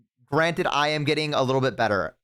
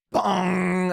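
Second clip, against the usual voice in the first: imitated alert bong; 3 semitones higher than usual. Player mimics the sudden enemy alert with a sung bong. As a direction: voice the game's warning chime.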